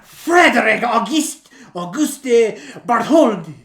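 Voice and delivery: put-on loud, high hammy French accent. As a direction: declaim it like an outraged Frenchman. Keeps up the praised French accent.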